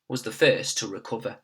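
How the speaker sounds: usual speaking voice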